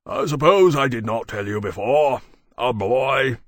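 loud, grumbling put-on voice; Berkeley, gloomy. A narrator's reading. Berkeley telling Lawrence of the baby, gloomily. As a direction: break bad news with heavy gloom. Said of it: "Berkeley said to Lawrence gloomily".

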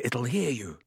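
hushed, frantic warning. A narrator's reading. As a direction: panicky undertone. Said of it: "said Harry frantically".

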